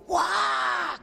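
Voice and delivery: sudden high wild shout; crazy loud yell. Retold or imitated. A lady at a meeting yelling crazy loud. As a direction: belt out a startling high cry. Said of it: "crazy loud".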